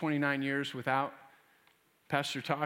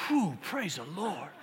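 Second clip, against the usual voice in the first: relieved sigh; slower than usual. Retold or imitated. Relieved at the cliff edge.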